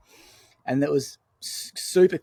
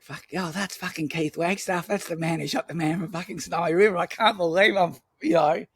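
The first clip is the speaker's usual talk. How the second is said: higher, awed, swearing in disbelief; gobsmacked to meet a legend, rising; starstruck disbelief; 6 semitones higher than usual. Retold or imitated. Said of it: "It's like".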